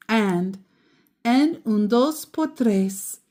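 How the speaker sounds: ordinary delivery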